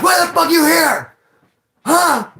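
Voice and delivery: slow, high, loud yell at a coworker. Retold or imitated. Yelling at the coworker he thinks is hiding something, loud and slow. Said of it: "I guess I was yelling".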